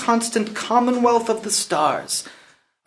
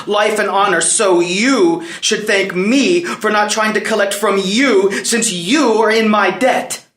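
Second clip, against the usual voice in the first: raised, insistent reproach; defiant; 3 semitones higher, 4 dB louder than usual. Acted. Prince claims the debt from his father, defiant. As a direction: press each point home, voice up.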